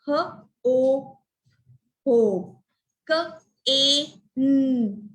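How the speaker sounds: each sound of "H, O" and "K, A, N" said separately, with pauses; segmented phonics demo, long vowels held; sounded out letter by letter. Shown as a demonstration. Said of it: "So which are such words?".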